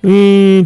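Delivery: a tearless put-on cry; imitated whine-cry. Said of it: "って泣いて".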